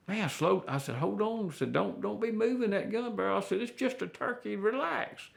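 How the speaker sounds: high, soft hushed reassurance to a nervous hunter; hushed coaxing. Retold or imitated. Hushing a nervous new hunter.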